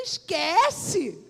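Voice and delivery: loud dismissive cry; emphatic shout. Retold or imitated. Secretary telling Fernando to drop the concert plan, loudly. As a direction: shut the idea down with force.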